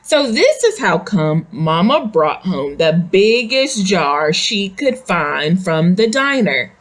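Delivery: ordinary delivery, nothing marked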